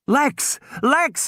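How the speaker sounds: high urgent call; frantic high yell; screaming a name. A narrator's reading. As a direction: shout her name in panic. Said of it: "he was screaming".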